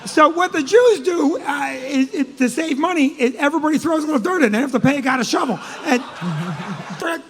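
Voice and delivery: usual speaking voice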